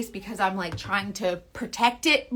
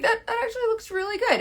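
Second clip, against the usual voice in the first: high, quick impressed voice; mock surprise; 12 semitones higher, faster than usual. Imagined viewer admiring her coverage, mock surprised. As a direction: play the amazed convert, high and fast.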